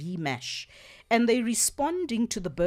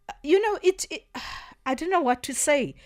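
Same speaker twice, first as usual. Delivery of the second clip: exasperated; 7 semitones higher, 4 dB louder, faster than usual. Real, not acted. Speechless after reading racist comments.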